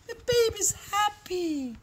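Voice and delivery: plain reading voice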